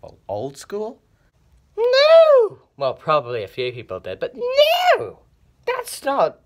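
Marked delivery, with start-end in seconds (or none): 1.8-2.6 high loud no; scandalised high yell; outraged shout. Acted. Outraged denial. 4.2-6.4 high loud no; scandalised high yell; outraged shout. Acted. Outraged denial again.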